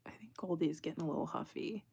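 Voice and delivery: soft unvoiced murmur; hushed note about the dog; whispered aside. Real, not acted. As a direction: whisper it so the dog can't hear. Noticing the dog quietly.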